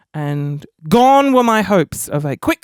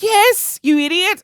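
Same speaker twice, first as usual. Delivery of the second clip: high-pitched, emphatic mock scolding; exasperated; 10 semitones higher, slower than usual. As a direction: imitate a friend fed up with you. Friend insulting him in retelling.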